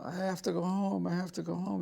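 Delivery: mock a grown man whimpering to be sent home; imitating a crybaby, half-hearted whine; whiny big baby. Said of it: "He's like a big baby".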